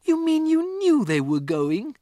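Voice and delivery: put-on high, loud, hurt child's question; feeling betrayed; shocked girl. Matilda to Miss Honey, high shocked cry. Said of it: "Matilda cried".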